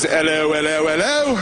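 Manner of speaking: imitating an English bobby's "hello, hello, hello"; a hint of a London copper's drawled greeting. Mocking the customs officer, lightly voiced English bobby. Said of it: "a test that goes".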